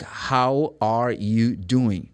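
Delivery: very slow, every word separate; careful textbook enunciation. Shown as a demonstration. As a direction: spell out each word slowly, no reductions. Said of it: "you're gonna go like".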